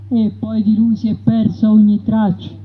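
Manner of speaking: plain reading voice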